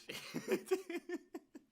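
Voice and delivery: high, helpless laughter; real laughter. Real, not acted. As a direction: dissolve into laughing. Laughs again.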